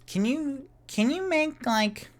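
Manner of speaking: very high, halting voice; tipsy pleading request. Acted. Tipsy patron asking Bonnie for food, high-voiced request. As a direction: ask in a high voice, restarting as you go.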